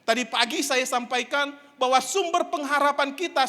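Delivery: usual speaking voice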